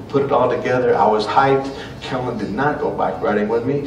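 ordinary talk, nothing marked

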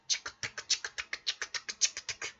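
soft clock-tick whisper. Acted. Streamer plays the quiz timer, whispering. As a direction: tick like a quiz countdown, all breath.

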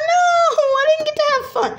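very high, loud whiny protest; whiny wail. Acted. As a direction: wail that you missed out. Character wails in disappointment.

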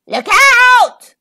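loud, high croaked warning; croaking shout. A narrator's reading. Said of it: "Crocodile croaked".

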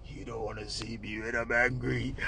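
very deep, hushed mock threat; exaggerated low-voiced menace; angry man. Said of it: "what kind of angry man this".

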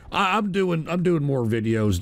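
ordinary talk, nothing marked

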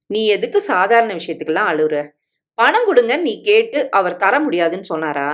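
ordinary delivery, nothing marked

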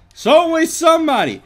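high, loud wailing cry; dramatic high shout. Pretend. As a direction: wail it out dramatically. Dramatic outburst at attacker.

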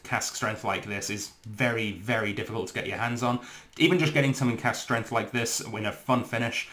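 usual speaking voice

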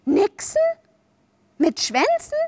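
high, rising astonishment; wide-eyed wonder; amazed questioning. A narrator's reading. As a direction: ask in thrilled disbelief. Wendy amazed by mermaids.

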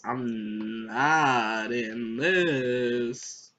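very slow, low singsong; slow, intoned refusal; drawn-out denial. Pretend. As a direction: stretch every word right out. Repeated refusal, stretched out.

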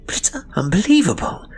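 can't believe her eyes; astonished. A narrator's reading. Sister staring into the microscope, astonished, to her brother. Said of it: "a tone of astonishment".

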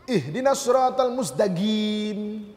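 Yemeni Arabic accent, mustaqim ending in a hard G. Shown as a demonstration. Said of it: "huruf Qaf jadi huruf Ga".

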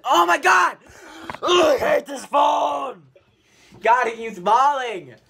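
loud annoyed shout; frustrated yelling. Real, not acted. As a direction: yell at the phone. Phone keeps falling, frustrated.

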